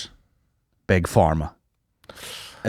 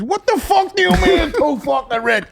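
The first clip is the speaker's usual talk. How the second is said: high, loud mock-furious shout; mock Italian outrage; 4 dB louder than usual. Twin yelling at his dad over the sauce, hammed-up fury. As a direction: explode like an Italian-American twin. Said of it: "And he goes".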